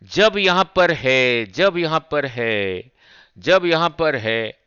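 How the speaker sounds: slow, deliberate phrase, repeated for students; slowly. Shown as a demonstration. Teacher to students, promising to go slow: slow and deliberate. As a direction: spell it out slowly so the class follows. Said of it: "अब धीरे-धीरे बोलूंगा".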